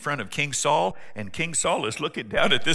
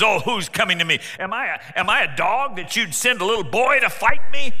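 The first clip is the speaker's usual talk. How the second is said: higher, loud sneering taunt; mock the boy like a towering bully; mocking giant; 6 semitones higher, 7 dB louder than usual. Goliath mocking the boy sent against him, loud. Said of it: "He mocks him and says".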